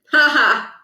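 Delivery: saying "ha ha" as words, not laughing; deadpan spelled-out laugh; flat mock "ha ha"; spoken ha ha. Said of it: "He actually said".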